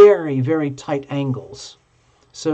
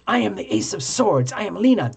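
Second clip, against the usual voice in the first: higher, lightly hammed game hero; a hint of a grand card warrior announcing herself; 5 semitones higher than usual. Lena as a game character announcing herself, mock heroic. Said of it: "Lena could be going like".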